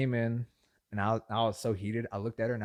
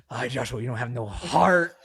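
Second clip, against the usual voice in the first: exasperated mom; 7 dB louder than usual. Retold or imitated. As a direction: imitate a mom's dismayed reproach. Mom scolds him in retold exchange.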